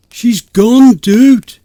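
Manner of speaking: high, loud alarmed exclamation; exclaimed. Acted. Friend shouts to narrator that Amanda vanished, loud alarmed exclaim. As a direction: shout the bad news. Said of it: "he exclaimed".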